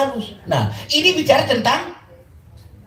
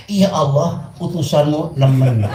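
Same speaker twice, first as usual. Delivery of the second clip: mock prayer; 10 semitones lower than usual. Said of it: "rendang mantu sama Gusti Allah".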